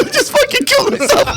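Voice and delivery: high, cracking half-laughed wail; laughing wail. Retold or imitated. Panicked guy wailing alone in his car. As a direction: overblown sobbing doom. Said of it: "I'm like".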